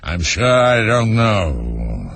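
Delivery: slow lion growl; drawn-out grumbling lion. A narrator's reading. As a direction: growl it out lazily, dragging the words. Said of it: "the lion growled out".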